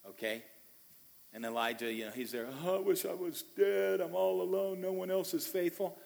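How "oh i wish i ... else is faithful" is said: higher, self-pitying whine; mock despair, feeling sorry for himself; woe is me. Elijah alone in the cave, mock self-pitying whine. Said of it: "woe is me".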